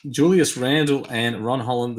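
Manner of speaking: usual speaking voice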